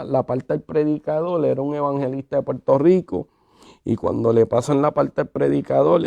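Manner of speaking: normal talking voice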